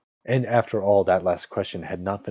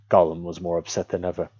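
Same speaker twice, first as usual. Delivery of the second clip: ordinary delivery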